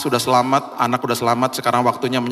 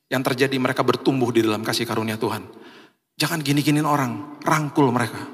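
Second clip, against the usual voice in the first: normal talking voice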